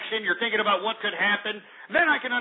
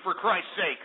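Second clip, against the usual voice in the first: normal talking voice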